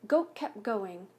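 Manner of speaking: plain reading voice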